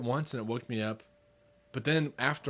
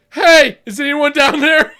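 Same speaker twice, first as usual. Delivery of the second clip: much higher, loud, slow call down the stairs; 14 semitones higher, 15 dB louder, slower than usual. Retold or imitated. Checking the basement for intruders, loud slow call. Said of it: "This is what I said".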